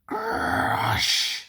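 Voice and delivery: high breathy huff; sulky grunt. A narrator's reading. Mavis huffing at Toby's teasing. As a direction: huff at him in a sulk. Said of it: "said Mavis".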